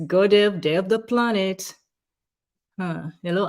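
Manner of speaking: ordinary talk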